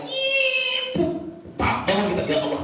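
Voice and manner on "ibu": very high, sustained mosquito buzz; thin held high-pitched insect drone; mosquito whine. Acted. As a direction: imitate a mosquito whining by the ear. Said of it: "kena gigit dengan nyamuk".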